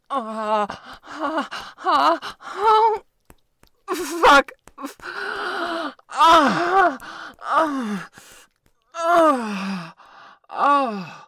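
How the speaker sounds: high, loud rising moans of release; breathless ecstatic ah cries; climax cries. Acted.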